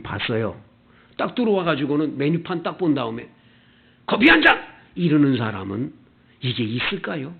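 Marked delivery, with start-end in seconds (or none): 4.0-4.6 loud, high, brusque coffee order; curt order. Acted. Rude customer throws an order at café staff, curtly. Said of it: "퉁명스럽게 하면 만 원이니까".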